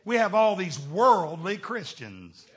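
usual speaking voice

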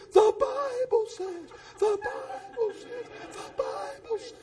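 fading echo, high and slow. Retold or imitated. His voice echoing three blocks away, fading high echo. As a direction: let it ring away into the distance. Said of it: "for three blocks you could hear".

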